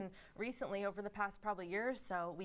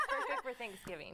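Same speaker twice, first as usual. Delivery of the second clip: laughing; 10 semitones higher, 3 dB louder than usual. Real, not acted. Laughing along with host.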